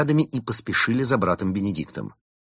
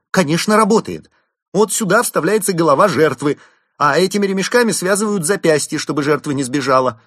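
proud loud boast; 11 semitones higher, 9 dB louder than usual. Acted. Proudly demonstrates machine.

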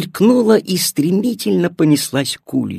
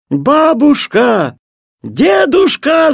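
high, drawn-out calling; children's joyful cry; 10 semitones higher, 4 dB louder, much slower than usual. A narrator's reading. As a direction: call out slowly and high to the grandparents. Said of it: "закричали дети". (радостный детский крик)

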